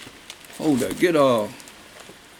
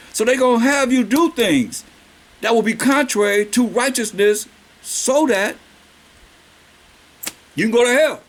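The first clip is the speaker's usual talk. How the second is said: preaching force; 5 semitones higher than usual. Acted. Preaching warning.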